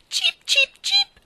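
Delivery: very high, loud bird chirp. Acted. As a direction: chirp a happy yes like a lark. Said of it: "assentì l'uccellino".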